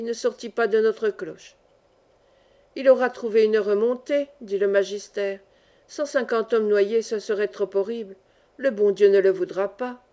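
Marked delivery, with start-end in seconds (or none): none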